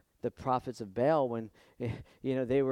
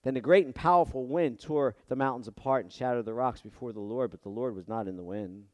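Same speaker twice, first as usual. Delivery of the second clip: usual speaking voice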